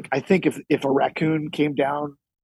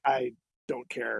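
low, slow and quiet; lightly voiced tough dog, taking its time; unimpressed big dog; 3 semitones lower, 8 dB quieter, slower than usual. Unbothered big dog facing a raccoon.

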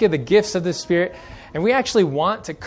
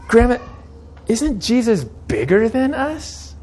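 put-on little girl voice; 6 semitones higher, slower than usual.

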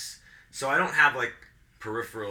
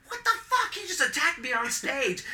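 very high, squealing mock outrage; retold screaming protest. Performer protesting to bartender about the attack, screamed outrage. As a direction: re-enact an indignant protest at a shrieking pitch. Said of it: "I'm, like, screaming".

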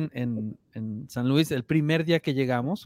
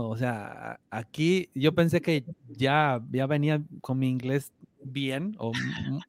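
ordinary talk

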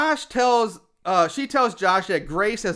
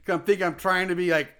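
normal talking voice

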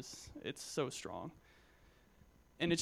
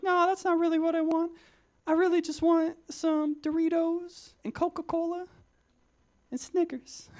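much higher, louder put-on voice; homeless man's voice; 14 semitones higher, 6 dB louder than usual. Youth speaker imitates homeless man's greeting, high put-on voice. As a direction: pitch way up like the homeless guy greeting you. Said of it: "That's actually what he sounded like".

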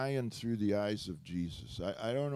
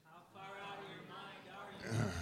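congregation yelling from far off; many voices shout together; crowd shouting back; 8 semitones higher, 11 dB quieter than usual. Real, not acted. Congregation shouting the line back at the preacher. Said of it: "everyone scream right out loud".

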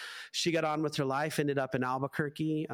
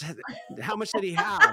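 high, louder and breaking into laughter; laughing question; 5 dB louder, faster than usual. Retold or imitated. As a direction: ask through a laugh. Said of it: "He goes".

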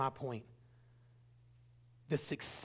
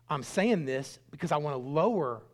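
normal talking voice

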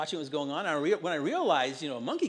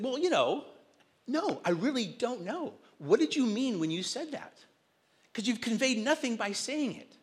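higher, pushy retold questioning; insistent challenge; 7 semitones higher than usual. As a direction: press him for an answer, a notch up. Pastor retells challenging the teen.

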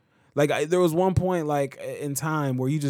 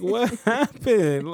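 slow, higher puzzled question; surprised; 8 semitones higher, much slower than usual. Retold or imitated. Dad retelling his puzzled question to his son, slow and high. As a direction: ask it drawn out, half laughing. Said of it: "And I'm like".

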